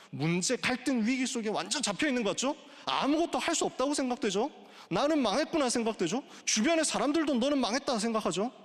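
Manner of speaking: high, mounting preacher's questions; impassioned preaching. Real, not acted. Preacher naming the congregation's despair, in high, impassioned preaching. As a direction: press the congregation with rising urgency.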